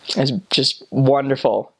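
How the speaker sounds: ordinary talk, nothing marked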